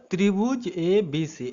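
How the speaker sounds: ত্রিভুজ ABC drawn out very slowly, each part separate; slow spelled-out reading. Shown as a demonstration. Teacher slowly reads the triangle symbol aloud to pupils. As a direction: read ত্রিভুজ ABC slowly and clearly for the class. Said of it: "এর মানে আমরা কি বুঝবো?".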